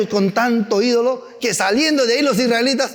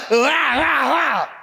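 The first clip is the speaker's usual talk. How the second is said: mock shouting husband; 5 semitones higher, much slower than usual. Husband's anger acted at home.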